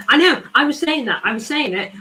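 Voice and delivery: high, loud exasperated cry; real exasperation at the defending; frustrated outburst. Real, not acted. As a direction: fan yelling in frustration. Frustrated fan over conceded goal.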